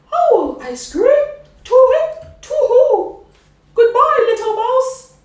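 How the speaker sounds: very high, loud hooting panic; terrified owl. Acted. Owl fleeing the mouse, shrieking high and loud.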